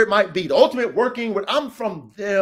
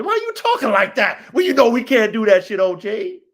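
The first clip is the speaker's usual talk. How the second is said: louder, incredulous comeback; challenging; 5 dB louder than usual. Acted. Coach questioning the OG's talk, loudly challenging. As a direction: call him out forcefully.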